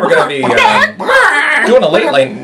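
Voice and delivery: hammed-up late night host; big showy announcer voice. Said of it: "I'll talk normal".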